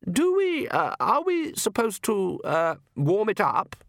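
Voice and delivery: unsure, trying to be supportive; nervous. A narrator's reading. Countess nervously asking her husband about the wine. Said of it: "His wife smiled nervously".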